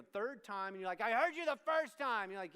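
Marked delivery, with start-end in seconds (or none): 1.0-2.3 loud, higher-pitched retort to mom; mock frustrated teen. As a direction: play an exasperated kid snapping back. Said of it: "you might get frustrated".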